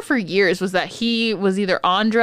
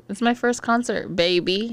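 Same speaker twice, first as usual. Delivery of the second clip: ordinary talk, nothing marked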